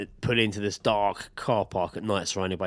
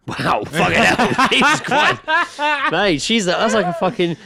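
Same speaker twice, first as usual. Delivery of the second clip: shocked laughing disbelief; 11 semitones higher, 6 dB louder, slower than usual. Real, not acted. Shocked reaction to story.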